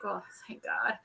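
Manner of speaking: very soft, voiceless retold aside; whispered aside. Mom retelling her reply to her child, whispered aside. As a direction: whisper it under your breath.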